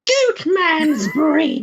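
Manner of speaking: very high, drawn-out squeaky title. Shown as a demonstration. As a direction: stretch it out way up in falsetto. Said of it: "You said it a little higher now?".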